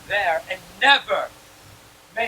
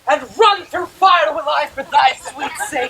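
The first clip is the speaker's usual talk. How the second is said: passionate outburst; 5 semitones higher, 7 dB louder than usual. Acted. Lysander wakes, smitten and passionate.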